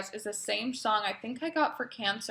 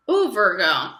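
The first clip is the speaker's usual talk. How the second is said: ordinary talk, nothing marked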